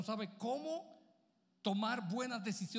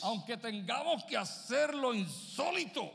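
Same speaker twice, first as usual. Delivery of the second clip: loud, raised preaching; fiery preaching; 3 semitones higher, 6 dB louder than usual. Acted. Pastor urges the congregation to submit with joy, loud preaching. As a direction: drive the point home to the church.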